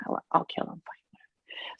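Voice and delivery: whispered shrug, fading to nothing; resigned whisper. Retold or imitated. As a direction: whisper it off, giving up. Resigned thought about killing plants.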